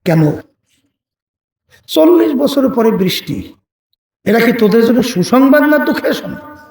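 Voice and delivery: mild retold rebuke with pointed questions; understated mock telling-off; angry scolding. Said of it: "নবী রাগ হইয়া ধমকে সুরে বলতেছে".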